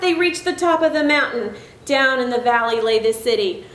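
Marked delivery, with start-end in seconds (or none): none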